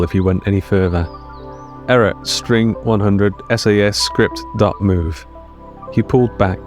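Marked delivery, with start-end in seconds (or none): none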